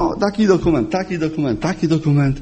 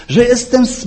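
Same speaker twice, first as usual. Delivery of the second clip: normal talking voice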